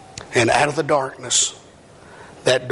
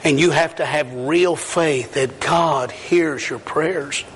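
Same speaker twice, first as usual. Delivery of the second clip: slow, every word weighted; slow emphatic preaching; slower than usual. Real, not acted. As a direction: spell out real faith deliberately. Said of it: "listen to me, young people".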